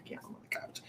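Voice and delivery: whispered guilty confession; sheepish whisper. Retold or imitated. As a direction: admit it in a hushed whisper. Sheepish whispered reply to Sam.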